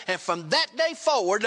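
usual speaking voice

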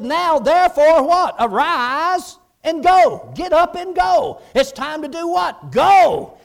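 loud, high preaching call to go; rousing shout. Real, not acted. Preacher urging the church to rise and go, shouting. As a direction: fire the congregation up to move.

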